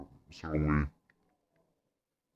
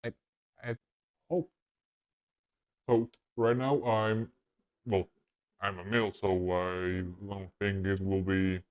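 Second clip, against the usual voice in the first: electronically pitch-shifted voice, higher and louder than his own; male voice changer setting; 6 semitones higher, 5 dB louder than usual. Real, not acted. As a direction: speak through the voice changer on its male preset. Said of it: "The male".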